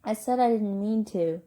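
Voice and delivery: loud, frustrated insistence; upset, defensive protest; angry frustration. Acted. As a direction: insist on it, voice starting to rise. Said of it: "hot tears of anger and frustration rising".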